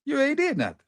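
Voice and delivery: very high-pitched scoff; incredulous mocking. Real, not acted. Football streamer to a celebrating player, incredulous high mocking. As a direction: squeal it in disbelief.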